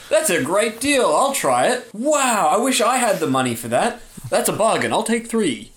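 oversell it like a planted fan in the crowd; eager hype man. Acted. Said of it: "a hype man who seems completely uninvolved".

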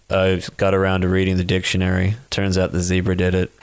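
low flat deadpan comic voice; very low, dry deadpan one-liner delivery; Stephen Wright deadpan. Retold or imitated. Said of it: "He does this really funny voice".

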